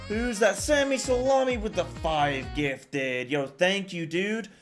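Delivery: high, loud, hyped-up thanks; subathon hype. Real, not acted. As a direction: cheer the gifter on. Streamer hyping a gifted sub.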